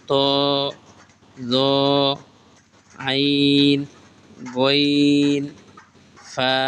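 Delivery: letter names stretched long, one by one; slow, stretched Arabic letter names; drawn-out recitation. Shown as a demonstration. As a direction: draw out each letter name like a Quran teacher. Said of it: "আমি যেভাবে টেনে টেনে পড়ে দেখেছি".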